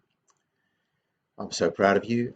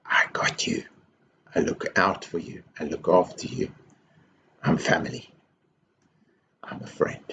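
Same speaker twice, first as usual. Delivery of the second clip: breathy hushed whisper, close and gentle; soft whisper; 2 semitones lower, 3 dB quieter than usual. Acted. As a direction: whisper it like a promise to a child. Hands that say, warm quote.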